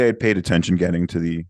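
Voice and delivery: ordinary talk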